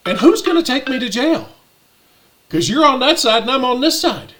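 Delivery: high, loud retort; indignant challenge. Retold or imitated. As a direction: challenge them to just try it. Retold challenge to the clerk.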